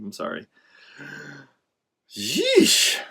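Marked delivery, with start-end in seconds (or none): 2.1-3.0 high cringing yeesh. Real, not acted. Vlogger cringing at own slip, high yeesh. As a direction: wince it out high.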